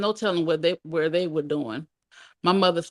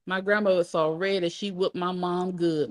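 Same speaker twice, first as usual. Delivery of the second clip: plain reading voice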